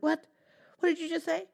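high, indignant what; suspicious question. Acted. Dog catching Cat's song, startled and suspicious. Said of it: "and he said".

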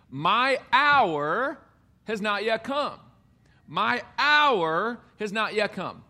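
"hour" lifted high and drawn out; emphatic key phrase. Shown as a demonstration. Preacher showing the key phrase of the text, emphatic.

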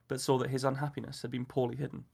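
usual speaking voice

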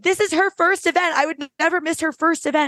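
high-pitched, gushing retelling; imitated stage speech, pitched well up; imitated guest speaker. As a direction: voice the guest proudly addressing the room. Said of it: "she was like".

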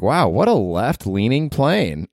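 slow, drawn-out dry quip; deadpan the joke, stretched slow; slow dry mock quip; dry mock Howard. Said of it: "Howard was like".

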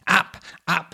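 high, loud order; commanding shout. A narrator's reading. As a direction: bark it at the pack. Cat orders climb.

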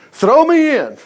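high, loud exclamation; emphatic repeat. Acted. Preacher marveling at Jonah's words, loud emphatic quote.

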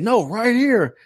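high-pitched insistent call; insistent buddy. Retold or imitated. Buddy keeps volunteering his friend, high insistent call. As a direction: imitate a pal who won't let it go. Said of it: "he keeps saying".

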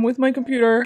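ordinary delivery

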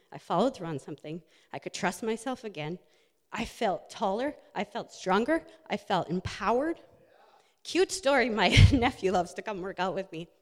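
normal talking voice

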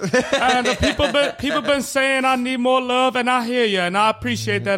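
Charles Barkley impression. Shown as a demonstration. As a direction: ramble earnestly like the TV analyst. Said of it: "Charles Barkley".

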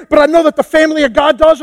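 ordinary delivery

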